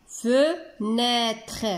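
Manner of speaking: "Fenêtre" in two held beats, fe and nêtre; syllable by syllable. Shown as a demonstration. Teacher recapping two syllables, syllable by syllable. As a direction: stretch "Fenêtre" with a short break after fe. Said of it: "Deux syllabes".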